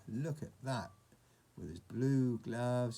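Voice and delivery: ordinary delivery, nothing marked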